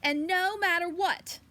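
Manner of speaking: a touch of a grandmother calling after; calling after her. Acted. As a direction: call out to the departing girl. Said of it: "Mama Frances called after her".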